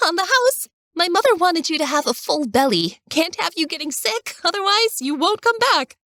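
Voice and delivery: put-on high, bubbly teenage girl voice; cheery girl. A narrator's reading. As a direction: chirp it like a lively teenage girl. Cheery girl Jenna greets guests.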